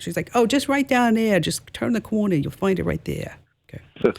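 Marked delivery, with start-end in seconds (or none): 0.3-3.4 imitated New York accent, starting high. Tourist directing her to the ladies' room, New York accent. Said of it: "she had a New York accent".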